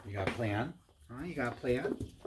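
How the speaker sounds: plain reading voice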